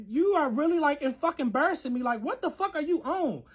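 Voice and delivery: high-pitched exasperated complaint; acted indignant confrontation. Telling boyfriend he is embarrassing her, exasperated and high. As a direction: retell the telling-off, pitched well up.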